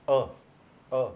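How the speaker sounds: a said as a clipped half uh sound; short schwa a. Shown as a demonstration. Teacher drilling the article, a short uh. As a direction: cut the अ short, just a quick uh. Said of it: "आधा कटा हुआ अ sound".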